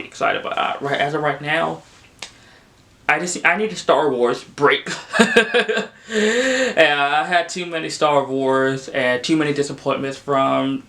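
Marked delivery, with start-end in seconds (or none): 5.0-5.9 quick amused chuckle; a light laugh at yourself; brief embarrassed laugh; short real laugh. Real, not acted. Self-deprecating aside.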